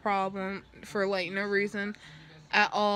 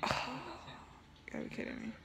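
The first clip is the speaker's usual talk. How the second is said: exasperated whisper; 8 semitones higher, 13 dB quieter, much faster than usual. Retold or imitated. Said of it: "And I was like".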